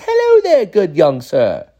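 high, loud hello easing down slowly; genie-like grandpa. Retold or imitated. Grandpa talking to a boy like a fairy or genie, high and grand. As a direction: do an exaggerated genie welcome. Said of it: "like, a fairy or, like, like the genie".

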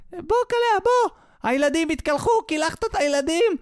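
very high mock wife's yell. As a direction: nag the husband in a high call across the house. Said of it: "צועקת לו מה-, מה-, מהמקלחת". (צעקות אישה בקול גבוה)

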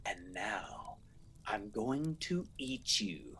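hushed, slow spooky whisper; whispered title. Acted. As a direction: breathe the title like a secret. Announcing the spooky story title.

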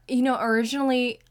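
ordinary talk, nothing marked

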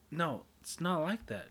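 ordinary talk

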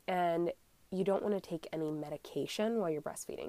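ordinary talk, nothing marked

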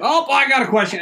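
high, loud, chirpy question; mocking an eager questioner. Guy at court meeting asks a question, eager mock voice. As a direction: sound eager and oblivious.

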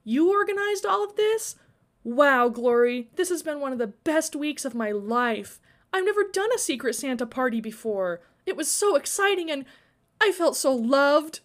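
high-pitched, delighted wonder; lifted voice, drawing out key words; amazed gratitude. A narrator's reading. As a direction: gush with grateful amazement. Friend moved and amazed by surprise.

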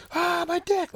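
very high, loud pretend cry of pain; mock pained yelp. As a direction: squeal it like it just blew up. Dick explodes while smoking.

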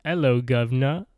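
mock a bad British accent; the suits fake a bad British accent; terrible British accent. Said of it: "exactly like that, where we can all tell that's from England".

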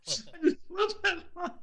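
high-pitched, laughing through the words; real laughing. Real, not acted. Host delighting in a favorite line, laughing. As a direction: crack up while saying it.

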